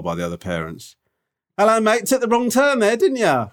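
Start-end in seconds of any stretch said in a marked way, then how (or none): 1.6-3.5 high, teasing parent's jeer; mocking heckle. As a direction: imitate a jeering parent much higher.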